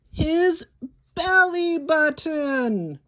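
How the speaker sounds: very high, loud discovery; delighted reveal. A narrator's reading. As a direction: reveal it like a surprise gift. Surprise reveal to kids.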